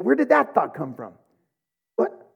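ordinary delivery

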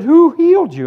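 high, loud, incredulous question; acted-out amazement, pitch leaping up; astonished. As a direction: demand it in loud disbelief. Astonished doctor demands an answer.

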